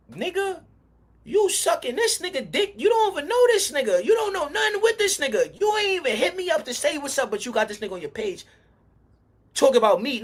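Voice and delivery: angry retold rant at a rival; retold angry callout. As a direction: call him out like you're fed up.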